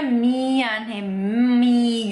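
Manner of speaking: "mian, mi" with a long humming M; Indonesian-style M. Shown as a demonstration. Teacher showing Indonesian learners their own way, clear M. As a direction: stress a full, nasal M in "mian". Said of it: "kalau kita kan ngomongnya".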